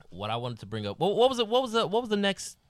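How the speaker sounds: ordinary talk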